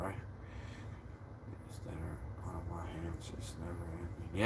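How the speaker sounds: barely audible whisper to himself; a defeated whisper. Real, not acted. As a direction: breathe the words out, no voice. Muttering to himself in despair.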